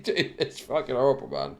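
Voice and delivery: ordinary delivery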